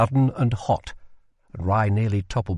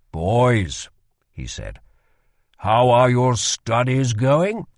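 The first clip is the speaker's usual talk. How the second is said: king's voice; 4 dB louder, slower than usual. Acted. King Maxim addresses the boys.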